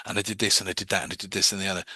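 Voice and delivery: hurried, run-on rattle at a brisk pace; rapid talker. Retold or imitated. Client talking at the hypnotist, quick rattling imitation. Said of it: "somebody that's talking to you like that".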